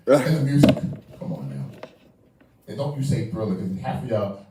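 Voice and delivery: ordinary talk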